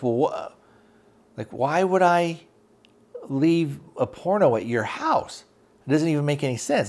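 a light retold why-would-I protest; incredulous. Friend asked to take blame.

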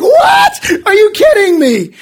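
disbelief at the bills news; shocked disbelief. Retold or imitated. Retold shock at wife's news.

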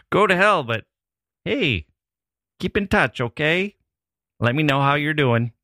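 put-on high, friendly Wisconsin accent; cheerful Wisconsin farewell, sing-song and high. Host imitating a friendly Wisconsin goodbye, Wisconsin accent. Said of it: "Rolling out the Wisconsin".

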